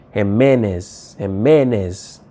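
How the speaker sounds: Jimenez slowly, stressing the middle MEN; stress on the accent. Shown as a demonstration. Teacher shows students the accent, Jimenez slowly stressed on MEN. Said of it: "a stress on a point".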